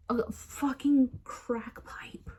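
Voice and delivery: slow, drawn-out whisper; whispered swearing. Real, not acted. Teller recounting a row over a pipe, whispering. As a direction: whisper the swear, stretching each word.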